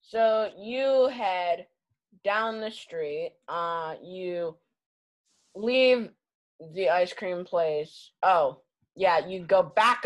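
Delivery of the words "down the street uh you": ordinary talk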